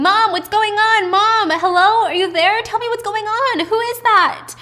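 high, loud frantic calls down the phone; a scared kid yelling into the phone; panicked daughter screaming. Retold or imitated. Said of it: "Jae-young is still on the phone screaming".

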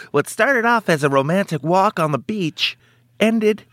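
slow, high dramatic true-crime narrator; announcer voice. Pretend. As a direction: intone it like a crime show host. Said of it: "in, like, an, an announcer voice".

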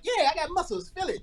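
a teenager flexing for a girl, lightly voiced; proud teen boy. Jeffrey showing off his muscles to a girl, lightly cocky. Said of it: "he'll be like".

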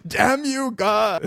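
high loud curse at the sky; melodramatic cry to heaven. Pretend. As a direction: shake your fist at God. Mock dying victim cursing heaven.

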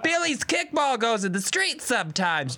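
a lightly voiced complaining neighbor; mock neighbor mom. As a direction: imitate the fussy neighbor mom. Neighbor's mom quoted, mocking.

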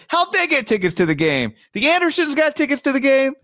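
high, sing-song announcer imitation; commercial announcer. Quoting Ian Eagle's commercial read to his cohost, in a high announcer voice. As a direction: belt the tagline like a TV ad. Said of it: "He'd be like".

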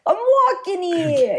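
high, slow New York "walking here" bark; a hint of a brash New Yorker; New York tough guy. New Yorker perceived abroad, playing up the tough New York accent. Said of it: "I'm perceived as".

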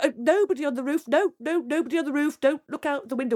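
high, gabbled denials; flustered princess. Acted. Princess covers for Jack on the roof, high flustered babble.

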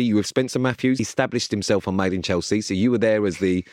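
ordinary talk

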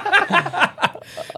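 a high squeaky laugh. Real, not acted. Laughing at friend.

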